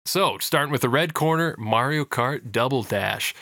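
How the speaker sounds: slow, drawn-out boxing announcer read; ring announcer. Acted. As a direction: call out the scores like a ring announcer. Host announces round scores.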